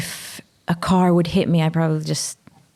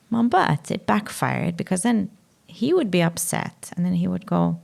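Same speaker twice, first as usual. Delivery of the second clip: ordinary talk, nothing marked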